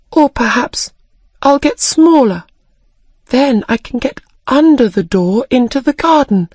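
thinking aloud with growing hope; young Alice plotting her way in; hopeful Alice. Acted. Alice voiced by narrator.

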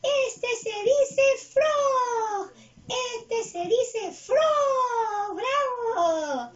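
very high, loud puppet voice; eager cartoon pupil, every word drawn out; Jelly Bean. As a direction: repeat slowly in a stretched, squealing character voice. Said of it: "Tú también me vas a ayudar".

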